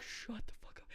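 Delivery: whispered "Shut the fuck up" through clenched fear; urgent whisper. Retold or imitated. Cousin whispering at drunk friend near homeless man, urgent whisper. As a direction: hiss "Shut the fuck up" under your breath. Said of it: "And I'm like".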